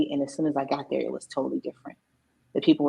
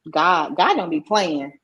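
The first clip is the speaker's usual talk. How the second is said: usual speaking voice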